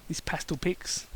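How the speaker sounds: soft unvoiced mutter; whispered aside. Real, not acted. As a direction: mutter it under your breath. Muttered aside about ponies.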